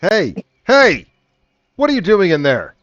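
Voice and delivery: high, loud challenge; shouted guard's warning; guard calling out. Acted. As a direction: call out sharply to a trespasser.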